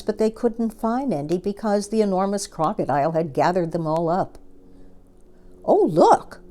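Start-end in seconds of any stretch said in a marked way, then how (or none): 5.6-6.4 put-on much higher, louder boyish cry; young boy spotting coconuts, excited. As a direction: call out in delight at the find. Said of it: "cried Toto".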